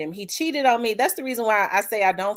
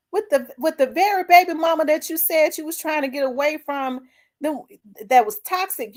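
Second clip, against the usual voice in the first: retold confrontation; 6 semitones higher than usual. Confronting ex over another baby.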